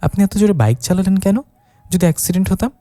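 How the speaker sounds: high-pitched, lightly acted complaint; mildly tearful. A narrator's reading. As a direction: reproach him with a touch of tears. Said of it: "কাঁদতে কাঁদতে নেমে বলল".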